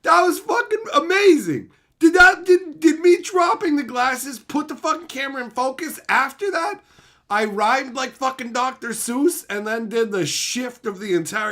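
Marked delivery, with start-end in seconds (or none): 0.0-9.5 high, loud amazed yelling; hyped shouting. Real, not acted. Streamer yelling at chat about the camera, loud and hyped. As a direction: blow up over the camera focus.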